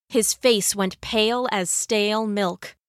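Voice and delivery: usual speaking voice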